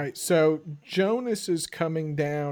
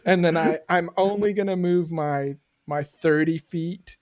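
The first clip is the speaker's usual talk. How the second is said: usual speaking voice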